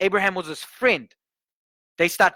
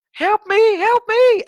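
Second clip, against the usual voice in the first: very high, louder pleading wail, imitated; crying for help; 7 dB louder, slower than usual. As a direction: wail it like a terrified girl. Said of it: "And she's crying".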